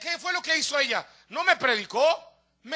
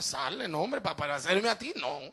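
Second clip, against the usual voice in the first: traumatized brush-off; 7 semitones lower, 3 dB quieter than usual. Acted. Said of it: "Todo traumado".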